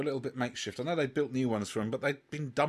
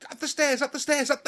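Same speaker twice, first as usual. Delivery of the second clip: much higher-pitched, repeated frantic urging; retold frantic urging; 11 semitones higher, 3 dB louder than usual. Fan retells watching cliffhanger with friends, frantic urging. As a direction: relive willing it up the stairs.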